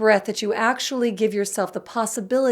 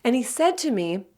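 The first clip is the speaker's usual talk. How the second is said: ordinary talk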